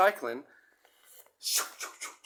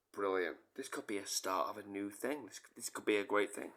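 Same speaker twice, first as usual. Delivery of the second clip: hushed inner thought; 7 semitones lower, 10 dB quieter than usual. Retold or imitated.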